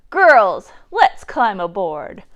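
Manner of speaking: loud boarding call to her crew; pirate captain yelling. A narrator's reading. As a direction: bellow it across the deck. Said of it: "a woman pirate captain yells".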